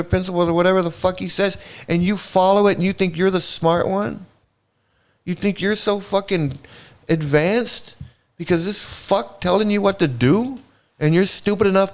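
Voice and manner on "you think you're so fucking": ordinary talk, nothing marked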